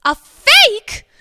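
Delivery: comic shocked double take; shocked disbelief. Acted. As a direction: build from a stunned question to a loud cry. Villain learns diamond is fake.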